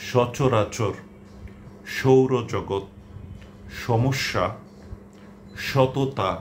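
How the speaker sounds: each স word said with a clear sh, one by one; Bengali sh sound. Shown as a demonstration. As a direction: read the list slowly, every স as sh. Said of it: "উচ্চারণ হচ্ছে স এর মতো". (প্রতিটি স শ-এর মতো স্পষ্ট উচ্চারণ)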